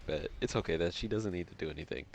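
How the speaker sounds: usual speaking voice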